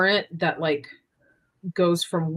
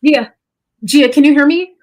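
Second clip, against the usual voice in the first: urgent high call across minds; 8 semitones higher, 12 dB louder, faster than usual. Acted. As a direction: shout for her, desperate. Frantic telepathic call to Gia.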